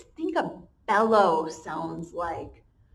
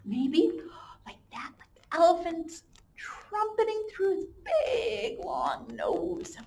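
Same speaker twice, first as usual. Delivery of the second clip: elephant trumpet; 8 semitones higher than usual. Shown as a demonstration. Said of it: "Can you guys make one?".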